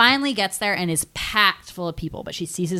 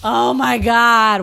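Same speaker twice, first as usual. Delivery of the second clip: slow, louder, drawn-out emotional exclamation; gushing; 6 semitones higher, 6 dB louder, much slower than usual. Real, not acted. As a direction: gush over a scene you adore. Moved by retold film ending.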